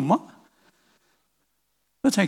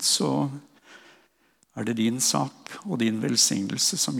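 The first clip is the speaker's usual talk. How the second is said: low, soft spoken prayer; hushed closing prayer with measured pauses; 5 semitones lower, 4 dB quieter than usual. Real, not acted.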